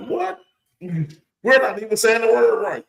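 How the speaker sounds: high, incredulous, drawn-out complaint; mock astonishment. Recalling finding out the real pronunciation, astonished. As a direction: pitch it up in exaggerated disbelief.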